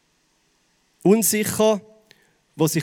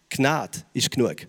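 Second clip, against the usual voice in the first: leiser Zuspruch; 6 semitones lower, 5 dB quieter than usual. Acted. Zuspruch an die Zuhörer.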